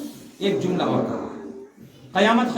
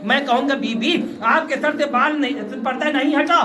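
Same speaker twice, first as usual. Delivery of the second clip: raised, high-pitched mourning oratory; lamenting preaching; 12 semitones higher than usual. Acted. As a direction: build from urgent telling into a sustained high wail. Reciter preaches at full pitch. (मजलिस में ऊँची, दर्द भरी ख़िताबत)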